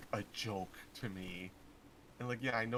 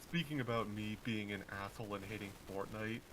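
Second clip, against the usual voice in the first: usual speaking voice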